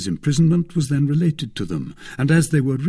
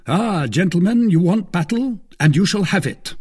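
raised, high, louder challenge; battle challenge; 9 semitones higher, 6 dB louder than usual. Acted. Athos dares the gentlemen to battle, loud defiance. Englishman or host threatened with battle.

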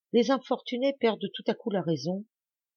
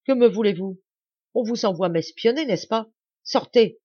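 louder and higher, rising to a shouted order; menacing, then ordering out; 5 semitones higher, 7 dB louder than usual. A narrator's reading. Lacheneur accuses the farmers of spying and orders them out, loud and menacing. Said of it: "d'un ton menaçant".

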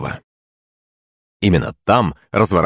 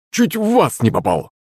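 startled, pitched-up character voice; exclaiming; 8 semitones higher than usual. A narrator's reading. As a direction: exclaim in the servant's high-pitched voice. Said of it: "воскликнул слуга".

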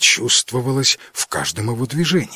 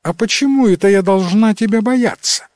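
голос девушки; 9 semitones higher, 5 dB louder than usual. A narrator's reading. Девушка отвечает дракону.

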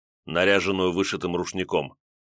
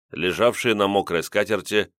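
ordinary delivery

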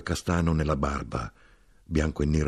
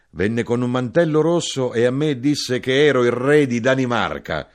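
high, loud proud declaiming; excited boast; 7 semitones higher, 7 dB louder than usual. A narrator's reading. Gnome boasting of his royal role, loud and excited. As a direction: announce it grandly, raised voice.

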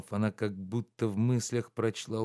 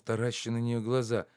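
plain reading voice

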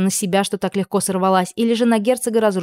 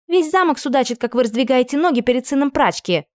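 high, spiteful taunt; insolent; 7 semitones higher than usual. A narrator's reading. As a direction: throw the gossip in her face. Maid insults lady.

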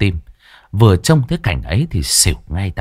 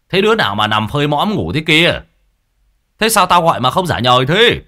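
raised, higher-pitched scolding bellow; irritated shout; 6 semitones higher than usual. A narrator's reading. Old Đinh yelling at a sleeping youth, irritated shout. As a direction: bark at the lazy boy who won't answer. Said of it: "lại quát lên sang sảng".

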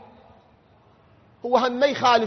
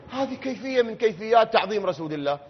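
ordinary delivery

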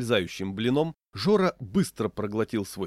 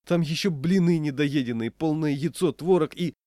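high, loud pleading list; exasperated wail; 6 semitones higher, 5 dB louder than usual. A narrator's reading. Diner lists his uneaten food, exasperated wail. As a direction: plead the evidence, worked up and exasperated. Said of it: "застонал я".

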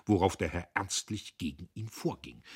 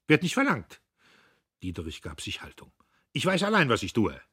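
curt retort; 5 semitones higher, 7 dB louder, faster than usual. A narrator's reading. Diederich curt reply.

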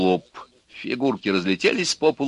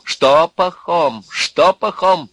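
irritated retort; 8 semitones higher, 7 dB louder, much slower than usual. A narrator's reading. Irritated retort, slow, loud.

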